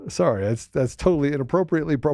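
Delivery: usual speaking voice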